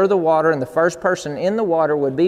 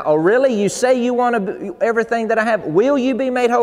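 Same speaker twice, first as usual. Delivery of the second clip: higher, insistent challenge; firm challenging God; 7 semitones higher than usual. Retold or imitated. God pressing the preacher on his commitment, higher and firm. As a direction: press him hard, question after question. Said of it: "this Johnson paraphrase now".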